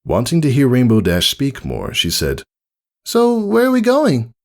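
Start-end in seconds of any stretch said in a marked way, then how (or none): 3.0-4.3 high, shy little filly; small admiring voice; lightly voiced filly. Scootaloo answering the mare, shy filly voice.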